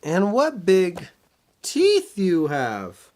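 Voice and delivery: put-on high, slow wondering girl; little girl voice. Minnie noticing the big ears, high slow wonder. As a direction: marvel slowly at granny's ears.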